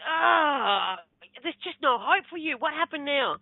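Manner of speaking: high, drawn-out groan of despair; exasperated husband. Retold or imitated. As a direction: imitate a husband giving up on someone. Exasperated husband sighs at her.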